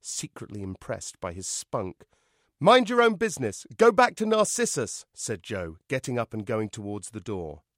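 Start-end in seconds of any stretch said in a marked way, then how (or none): 2.6-5.0 loud, high angry shout; defiant retort. Acted. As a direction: shout back at your mother through the door. Joe snaps back at mother.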